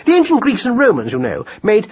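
high, fascinated lecturing; pedantic scholar mouse. A narrator's reading. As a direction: launch eagerly into a learned aside. Voices Marcus Aurelius.

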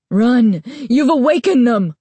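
high, loud scream, choked with fear; fearful scream. A narrator's reading. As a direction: scream in panic. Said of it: "the store owner screamed, his voice choked with fear".

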